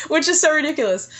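laughing through the words, pitched high; real laugh at her own silliness. Real, not acted. Telling her host about crying in the hut, laughing at herself.

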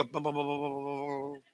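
soft, low mock knocking sounds; mock knee knocking. Preacher imitating a scared drunk's knocking knees, soft low mock bums. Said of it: "you go".

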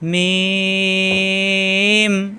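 মিম with a very long level vowel; drawn-out letter name. Shown as a demonstration. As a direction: hold the middle of মিম on one note. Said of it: "এখানে কিন্তু টান দিয়ে পড়া যাবে".

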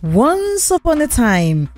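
ordinary delivery, nothing marked

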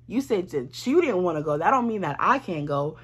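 ordinary talk, nothing marked

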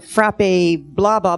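ordinary talk, nothing marked

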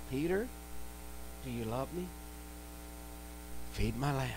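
Jesus softly asking Peter if he loves him; soft, gentle Jesus. Acted. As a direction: ask it gently, as a loving Master.

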